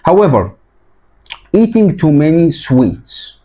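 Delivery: teacher reading the passage; reading aloud to class. Shown as a demonstration. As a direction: read it out clearly for learners. Reads text slowly.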